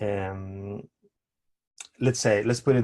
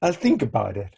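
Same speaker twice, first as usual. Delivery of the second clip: polite brush-off; 7 semitones higher than usual. Pretend. Dancer politely dodging unwanted feedback, sing-song high thanks. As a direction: brush the critic off with sweet politeness. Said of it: "you will do what? Go".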